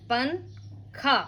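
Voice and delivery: पंखा धीरे, न को नाक में छुपाकर; पंखा में नून नाक से, धीमे और खींचकर; slow nasal pankha. Shown as a demonstration. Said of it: "नून को क्या करेंगे, इफ़ा कर देंगे". (पंखा को नून की इख़फ़ा के साथ धीरे बोलें)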